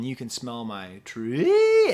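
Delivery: last word shoots up high and loud; voice goes way up. Retold or imitated. Imitating the rapper's lyric, voice leaping way up high. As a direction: stretch the final word into a high wail. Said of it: "his voice goes way up high".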